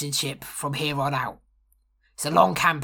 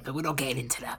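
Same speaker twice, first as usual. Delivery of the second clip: whispered aside; 7 dB quieter, faster than usual. Acted. Muttered aside to dogs.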